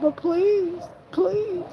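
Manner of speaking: high, pleading please; begging. Retold or imitated. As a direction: beg like a desperate teen. Teens begging mom for club.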